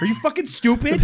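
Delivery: high incredulous laugh-yell; exasperated disbelief through laughter; laughing outburst. Real, not acted. Host reacting to the dumb nurse, laughing disbelief.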